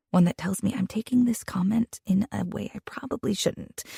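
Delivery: ordinary delivery, nothing marked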